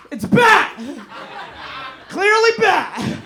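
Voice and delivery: loud laughing shout, high; excited shout. Retold or imitated. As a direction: yell it through laughter, insisting. Arguing over bat vs owl.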